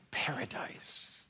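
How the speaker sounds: slow hushed whisper; whispered paradise. Real, not acted. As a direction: let "paradise" fall to a whisper. Whispered climax of sermon line.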